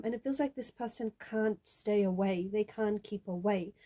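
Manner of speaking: normal talking voice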